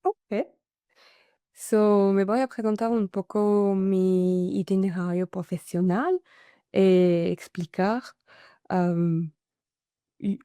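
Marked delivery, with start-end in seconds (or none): none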